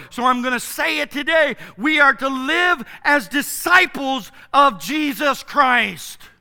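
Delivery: loud, high, emphatic sermon delivery; fervent pulpit proclamation; full-force preaching. Real, not acted. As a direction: proclaim it to the congregation, punching key words.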